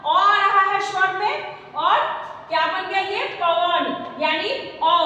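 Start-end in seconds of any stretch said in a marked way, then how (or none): none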